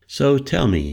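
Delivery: put-on light lilting Scandinavian accent; a lilting Swedish lilt, lightly voiced. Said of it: "she said in her lilting Scandinavian accent".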